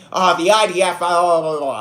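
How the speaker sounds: mocking a ranter. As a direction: rattle off someone's outrage dismissively. Mocks someone ranting online.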